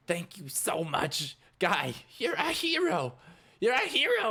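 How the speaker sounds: overwhelmed with thanks; grateful Scooter. Acted. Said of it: "He's like".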